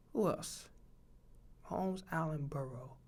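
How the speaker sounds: very soft murmur to oneself; tally the names under your breath; low, barely audible musing; thinking aloud. Real, not acted. Counting off names to himself.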